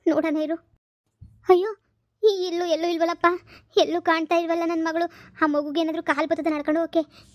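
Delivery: usual speaking voice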